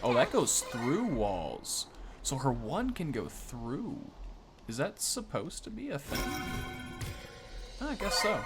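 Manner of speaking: ordinary delivery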